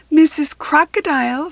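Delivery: bright hopeful call; eager call. A narrator's reading. Katy hopeful, calling crocodile.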